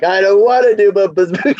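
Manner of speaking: imitated whiny kid. Daughter refusing grandpa's boot camp, imitated whining. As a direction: protest like a reluctant child, half laughing.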